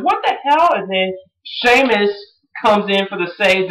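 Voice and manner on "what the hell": much higher, mock stunned; a fan reacting to an ambush; shocked what the hell. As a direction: let the disbelief jump up high. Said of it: "I was like".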